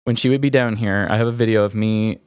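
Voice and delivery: usual speaking voice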